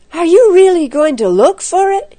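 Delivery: high, loud incredulous question; nervous disbelief. A narrator's reading. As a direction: ask in alarm, voice shooting up. Nervous boy questions the plan.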